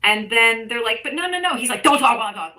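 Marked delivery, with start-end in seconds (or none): 1.0-1.6 higher, louder pleading no no no; mock protesting reporters. Reporters protesting as Trump talks over them, a louder, higher mock plea. 1.8-2.6 loud, bossy talk-over; mock Big Daddy. Trump silencing a reporter's question, imitated with a loud bossy order. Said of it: "he tries to fucking Big Daddy them".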